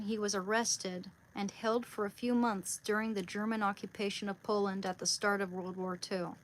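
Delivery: projected, measured biography reading; reading aloud. Real, not acted. Speaker reads the saint's martyrdom to viewers, reading aloud. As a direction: read it out clearly to an audience.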